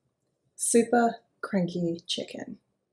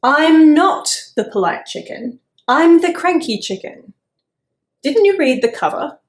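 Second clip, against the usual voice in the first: higher, louder indignant chicken; cranky chicken retort; 6 semitones higher, 10 dB louder than usual. Acted. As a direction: puff up and snap back at the worm. Chicken snaps at worm.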